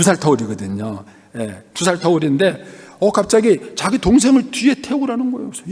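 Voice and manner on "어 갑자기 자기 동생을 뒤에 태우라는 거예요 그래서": ordinary delivery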